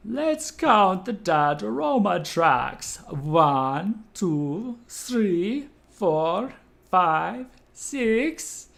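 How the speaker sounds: put-on Transylvanian Count accent, high and slow. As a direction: count it out like the vampire Count. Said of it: "I do the count voice".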